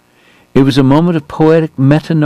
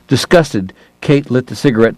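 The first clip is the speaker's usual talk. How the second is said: usual speaking voice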